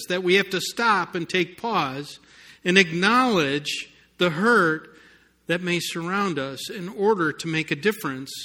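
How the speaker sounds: ordinary delivery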